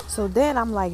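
usual speaking voice

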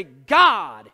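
big, forceful stress on "God"; emphatic GOD. Shown as a demonstration. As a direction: hit "God" hard, then pause. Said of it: "change it around and say".

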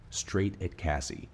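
usual speaking voice

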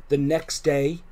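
usual speaking voice

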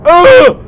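loud, high shriek; sudden real fright; startled scream. Real, not acted. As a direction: scream as the monster jumps out. Monster reappears, player screams.

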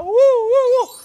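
high, loud "uh, uh" whoops; excited whooping. Acted. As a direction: whoop with mock glee about moving. Acting out excitement about moving.

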